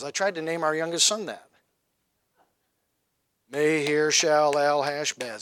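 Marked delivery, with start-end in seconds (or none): 3.5-5.4 "Maher-Shalal-Hashbaz" slowly, syllable by syllable; drawn-out syllables. Shown as a demonstration. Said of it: "That's a lot of syllables".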